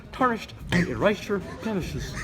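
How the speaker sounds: ordinary talk, nothing marked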